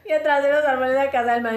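usual speaking voice

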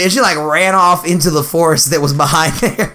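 ordinary talk, nothing marked

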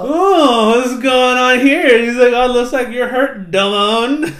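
loud, high cartoon-villain gloating; mock gloating villain. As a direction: feign surprise like a hammy villain. Villain gloats around the corner.

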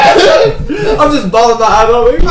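high, loud words through laughter; laughing. Real, not acted. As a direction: crack up mid-sentence. Laughing at own story.

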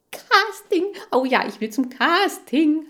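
high, loud hamster outburst; shrieky, over-eager cheer; noisy excitement. A narrator's reading. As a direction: burst out clamouring to take part. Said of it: "lärmte ich sofort los".

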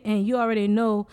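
usual speaking voice

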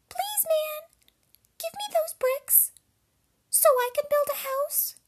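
very high, loud squeaky pig voice; little pig. A narrator's reading. Pig begs a man for bricks, very high squeaky voice. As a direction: squeak it out as a tiny piglet. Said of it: "The third little pig".

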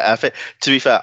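normal talking voice

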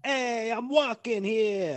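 loud, high, drawn-out New York shout; hammed-up New Yorker calling out; big brash New York accent. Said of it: "that's the inflection rather than the accent".

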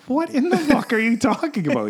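high, loud disbelief; incredulous outburst. Retold or imitated. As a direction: blurt it out, completely baffled. Retold baffled reply to stepdad.

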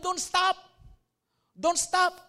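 loud, high shout begging them to keep going; desperate plea. Retold or imitated. Revived patient shouting at the doctor who let go, a loud desperate plea. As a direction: yell it as if your life depends on it.

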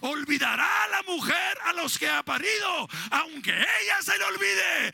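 loud, high proclamation of God's promise; preacher booming God's promise to his flock; full-force preaching. Acted. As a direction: build from firm to a shouted climax.

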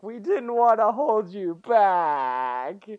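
drawn out teary confession; tearful plea. Retold or imitated. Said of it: "They're like".

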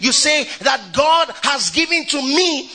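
high, ringing sermon cadence; preaching at full force. Acted. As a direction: preach it out to the whole church. Preaching the promise to the church.

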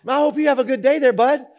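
hearty, put-on well-wishing; cheerful traffic cop. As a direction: imitate an imagined chummy cop. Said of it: "like, cheerful people".

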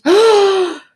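high, loud movie-mother shriek; gasp, then scream the name; acted sudden horror; panicked scream. Mother realises child is missing.

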